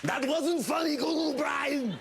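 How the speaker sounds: high, strained shouting character voice; hammed-up indignant yell; mock offended heckler. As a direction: holler the complaint high and slurred. Heckles himself in a put-on voice.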